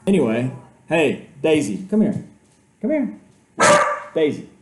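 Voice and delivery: loud, high call to Daisy across the house; calling out to the dog. Real, not acted. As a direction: call the dog over the noise, not yet worried. Calling dog, doorbell rang.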